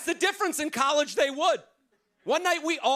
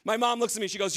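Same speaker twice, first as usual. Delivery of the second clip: ordinary talk, nothing marked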